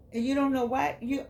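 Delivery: ordinary talk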